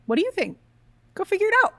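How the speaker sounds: mock tough-love husband snapping back; husband's blunt challenge. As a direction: toss it back at her, go figure it out. Husband's reply retold.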